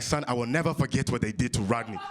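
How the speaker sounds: put-on solemn African father accent, low voice; African dad accent. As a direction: vow gravely in an African accent. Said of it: "my dad's African".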